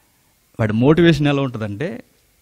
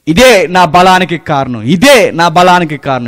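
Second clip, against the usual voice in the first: boastful king; 5 semitones higher, 11 dB louder than usual. Acted. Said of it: "king boasting, repeated".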